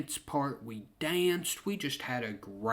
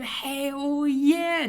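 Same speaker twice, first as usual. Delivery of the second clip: high, loud, stretched-out cheer; enthusiastic cheer; 13 semitones higher, 6 dB louder than usual. Retold or imitated. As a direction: cheer your friend on, pitched way up. Retold cheer for his friend.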